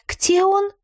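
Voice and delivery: high, timid question; trembling voice. A narrator's reading. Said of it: "дрожащим голосом спросила Радужка". (дрожащим голосом)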